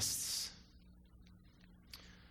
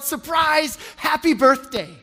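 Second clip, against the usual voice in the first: yelled surprise; 9 semitones higher, 7 dB louder, slower than usual. Retold or imitated. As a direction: shout it, higher and louder. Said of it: "they all yelled".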